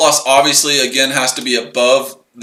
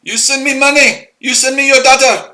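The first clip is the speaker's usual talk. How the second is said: put-on much higher caricature Indian accent throughout; mock Indian accent; 6 semitones higher than usual. Ranting at viewers as a scammer, high mock Indian accent. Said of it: "I speak India's accent".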